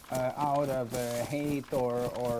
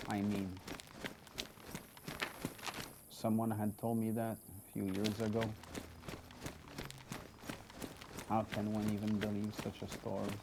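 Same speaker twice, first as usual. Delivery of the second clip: a lone survivor thinking aloud, quiet and lower; in-character survivor; 3 semitones lower, 8 dB quieter than usual. Acted. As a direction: muse to yourself while trekking alone, subdued.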